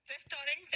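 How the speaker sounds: "रेस्टोरेंट" as res-to-rent, every syllable sounded; wrong pronunciation. Shown as a demonstration. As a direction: say "रेस्टोरेंट" with a clear final nt. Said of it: "नहीं बोलना है". ("रेस्टोरेंट" को ट के साथ पूरा बोलते हुए)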